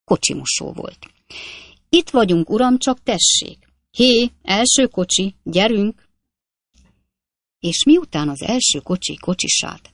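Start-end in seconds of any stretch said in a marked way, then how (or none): 1.9-6.0 higher, loud hawking of a cabman; eager cab washer calling out. A narrator's reading. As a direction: call the first cab over, eager and high. Washer calls out for cabs.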